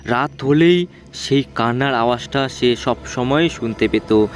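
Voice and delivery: ordinary delivery, nothing marked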